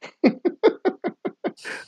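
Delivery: breathy wheeze of laughter; laughing. Real, not acted. Dad cracking up over his violin shop pun, wheezing laugh. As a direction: wheeze with laughter mid-story. Laughs at own tuna joke.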